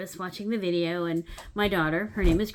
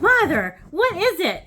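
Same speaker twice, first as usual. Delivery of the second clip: louder, higher urgent question; worried daughter; 10 semitones higher, 10 dB louder than usual. Retold or imitated. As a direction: demand an answer from mom. Worried daughter voiced in story.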